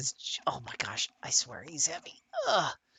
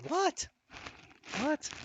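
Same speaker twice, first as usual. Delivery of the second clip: cooing surprise; 14 semitones higher, 4 dB quieter than usual. Acted.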